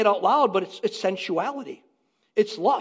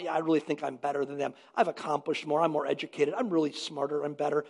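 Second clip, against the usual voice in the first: low, soft smug self-talk; arrogant inner voice; 7 semitones lower, 6 dB quieter, faster than usual. Retold or imitated. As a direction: mutter it like a secret boast. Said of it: "we don't say it out loud".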